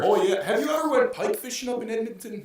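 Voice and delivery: high-pitched, hammed-up Canadian accent. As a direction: ask it like an eager Canadian fisherman. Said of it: "let's start with Canada".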